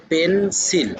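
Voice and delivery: "পেন্সিল" slowly in two parts, পেন, সিল; দুই ভাগে উচ্চারণ. Shown as a demonstration. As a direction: split "পেন্সিল" into two drawn-out beats. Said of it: "উচ্চারণ হবে দুইটা". ("পেন্সিল" দুই ভাগে টেনে টেনে: পেন-সিল)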